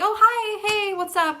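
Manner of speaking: high, sugary fake-friendly hello; mock friendly greeting. Girl faking friendship at a fight. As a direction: imitate her fake sweet greeting. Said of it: "just to be like".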